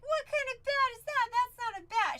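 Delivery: high, loud mocking kids, imitated; teasing boys. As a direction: jeer like boys teasing a girl. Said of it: "she got teased so bad".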